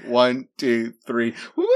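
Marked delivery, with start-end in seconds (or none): 1.6-1.8 cheering woo. Acted. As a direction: shout it like you're celebrating. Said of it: "let's do that on three".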